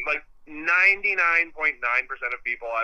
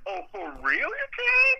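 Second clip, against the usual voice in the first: much higher, slow, hammed-up disbelief; mock amazement; slower than usual. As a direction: stretch it out in exaggerated wonder. Retold amazement at article.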